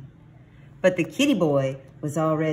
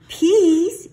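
loud, very high outburst; incredulous exclamation; 11 dB louder than usual. A narrator's reading. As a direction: blurt it out high in disbelief. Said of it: "exclaimed Scapito".

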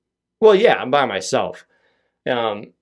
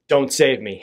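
ordinary talk, nothing marked

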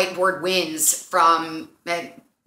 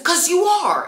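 high, loud imitated comeback; mock heated retort; 11 semitones higher, 5 dB louder than usual. Older guy firing back at British guy, mock heated. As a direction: throw back an exaggerated accusation.

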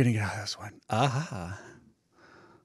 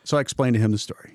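normal talking voice

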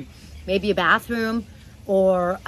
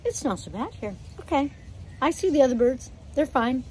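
voicing a new bird; 5 semitones higher than usual. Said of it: "The new bird goes".

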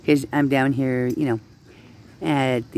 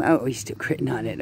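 normal talking voice

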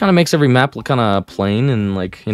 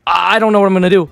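excited disbelief; 8 semitones higher, 3 dB louder than usual. Real, not acted. Survivor used dead hard.

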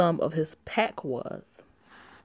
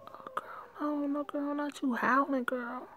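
startled whisper; 10 semitones higher, 6 dB quieter than usual. Real, not acted. Startled by a howl in the story.

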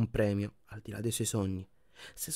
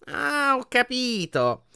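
very high, drawn-out sarcastic realisation; mock understanding; 13 semitones higher, 4 dB louder, much slower than usual. A narrator's reading. Portrait mocking Harry's excuse, exaggeratedly sarcastic. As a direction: stretch it out with exaggerated irony.

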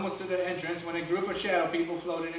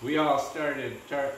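usual speaking voice

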